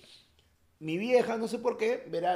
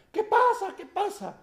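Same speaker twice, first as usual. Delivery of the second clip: put-on much higher fussy old lady asking; nosy lady; 5 dB louder, slower than usual. Passing lady asking what's going on, high-pitched mock. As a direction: pipe up like a startled passer-by.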